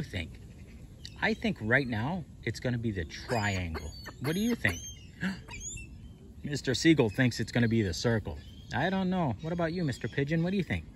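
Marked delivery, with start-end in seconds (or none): 5.2-6.1 sharp surprised high gasp; high squeaky gasp. Real, not acted. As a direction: gasp in delight. Surprise at chipmunk.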